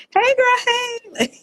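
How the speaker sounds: very high, put-on bougie girl hello; mock bougie greeting. Guest joking that she's super bougie, mock high-pitched greeting. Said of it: "I'm, like, super bougie".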